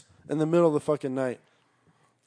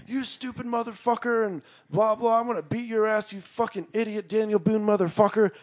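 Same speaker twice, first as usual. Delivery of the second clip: high-pitched imitation of angry yelling, held back in volume; mock yelling; 6 semitones higher than usual. Cousin's group heard yelling in the woods, mock yelling. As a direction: hurl insults in a high, contained mock shout. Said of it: "a bunch of yelling and screaming".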